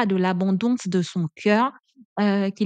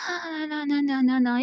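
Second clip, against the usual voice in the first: sly teasing singsong; 6 semitones higher than usual. A narrator's reading. The serpent teasing Eve, higher sly singsong. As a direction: taunt her playfully.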